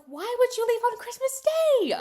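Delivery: very high, loud mock wail; hammed-up scandalised protest; outraged shriek. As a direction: shriek it in disbelief. Voicing sister's outrage at departure.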